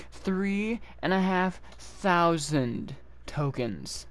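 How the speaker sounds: very slow, each word spelled out; slow deliberate count; slow emphasis. Real, not acted. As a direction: lean on the number, slowly.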